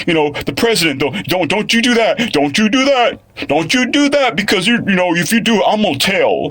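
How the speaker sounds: high-pitched nagging Karen impression; hammed-up bossy tattletale voice; mock tattling Karen. Mimics a Karen threatening to tell.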